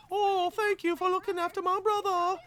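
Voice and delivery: put-on high falsetto girl voice; female character voice. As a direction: squeak it like a grateful girl. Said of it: "I don't think I sound anything like her".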